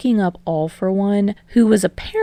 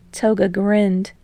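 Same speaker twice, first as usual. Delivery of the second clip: usual speaking voice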